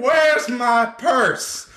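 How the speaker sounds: high, very slow mock wail; imitating a panicked shriek; mock scream. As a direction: drag it out in a shrill mock scream. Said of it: "she just kept screaming".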